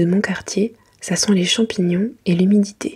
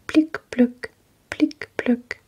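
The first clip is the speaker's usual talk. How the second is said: raindrop sounds; 5 semitones higher, much slower than usual. Retold or imitated. Imitating raindrops on the window.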